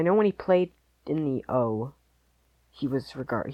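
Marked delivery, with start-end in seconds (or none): none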